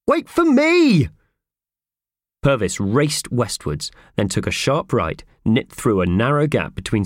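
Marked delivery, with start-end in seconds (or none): 0.1-1.1 high, drawn-out loud call; yelling after someone. A narrator's reading. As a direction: stretch it out as a yell to someone running ahead. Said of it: "yelled Howard, sprinting after him".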